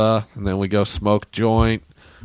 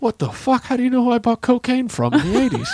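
high, baffled outburst; stunned disbelief; 13 semitones higher than usual. Retold or imitated. Robin Williams running back to the teller, high shocked outburst. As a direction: yelp it in total disbelief.

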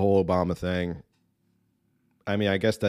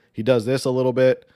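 ordinary talk, nothing marked

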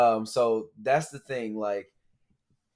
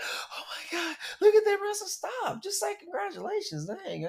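much higher, breathy mock coworker gasp; amazed coworkers; 6 dB quieter than usual. Podcaster imitates coworkers gawking at the ring, high breathy voice. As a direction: gush at the ring in disbelief. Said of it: "everybody at work was looking like".

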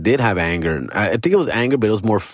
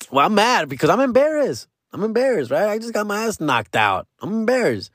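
put-on embarrassed drunk; 10 semitones higher than usual. Drunk self defending pride.

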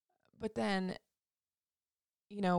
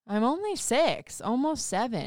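son's voice; 5 semitones higher, 6 dB louder than usual. Retold or imitated. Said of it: "And he's like".

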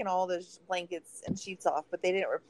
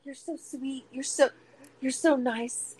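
higher-pitched mock gushing; gushing praise; 6 semitones higher, slower than usual. Retelling how they all fawned, gushing. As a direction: coo it like an admirer. Acting out others gushing at speaker.

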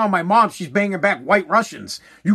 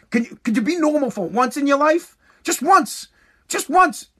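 exasperated dad; 6 semitones higher than usual. Retold or imitated. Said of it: "He just looked at me and be like".

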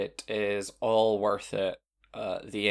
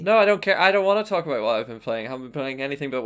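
emphatic protest; 4 semitones higher, 6 dB louder, faster than usual. Real, not acted. Insisting, refusing to talk.

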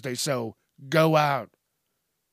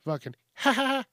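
sudden high-pitched giggle breaking the word; a high giggle he doesn't recognise as his own; odd high laugh; 9 semitones higher, 3 dB quieter than usual. Real, not acted. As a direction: burst into a strange squeaky laugh. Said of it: "I don't laugh like that".

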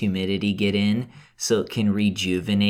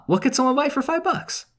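high squeaky voice; 14 semitones higher, faster than usual. Acted. Mock quizzical squeaky question.

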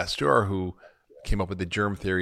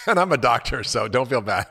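loud, amused, laughing through the words; real laugh in the voice; laughing self-mockery; 3 semitones higher, 6 dB louder than usual. Real, not acted. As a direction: joke at your own expense, breaking into laughter. Joking about own ignorance, laughing.

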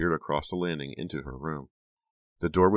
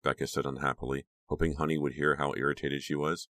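ordinary delivery, nothing marked

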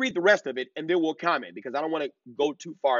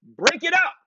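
raised, high-pitched yell; 13 semitones higher, 5 dB louder than usual. Retold or imitated. Man breaking up a group of girls, yelling. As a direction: call it out loud and high. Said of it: "can be heard yelling to the girls".

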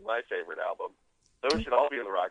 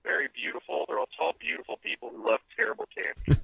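talking through a bad connection; degraded, toneless computer-like phone voice; robotic phone sound; 6 semitones higher than usual. Real, not acted. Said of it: "we're talking to Hal from two thousand one".

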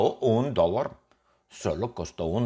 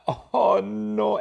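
high, drawn-out oh no; hammed-up horror; mock dismay; 13 semitones higher than usual. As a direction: lament the crash theatrically. Reacting to the accident in story.

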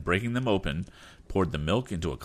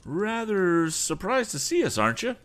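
smug taunt; 13 semitones higher, 3 dB louder than usual. Acted. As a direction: gloat with a smile in the voice.

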